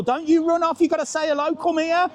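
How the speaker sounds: high-pitched, insistent coaxing; playful calling out. Real, not acted. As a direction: call them over in a high, cajoling voice. Calling a passer-by back on camera.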